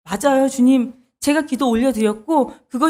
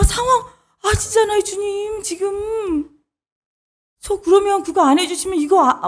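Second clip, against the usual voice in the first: high, insistent begging that keeps pushing back; desperate pleading; 7 semitones higher than usual. Acted. Believer protesting God's no, high desperate pleading. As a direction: argue with God in a high, wheedling plea. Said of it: "이런 대답".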